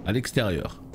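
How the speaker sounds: ordinary talk, nothing marked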